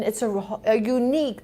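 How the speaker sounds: ordinary talk, nothing marked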